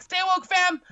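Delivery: high, loud mock-excited exclamation; hammed-up catchphrase. Repeats the acted catchphrase.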